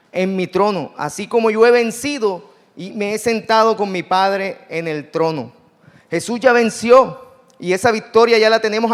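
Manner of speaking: proclaiming Jesus' victory to the church, full-force preaching. Acted. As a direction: preach the victory with everything you have.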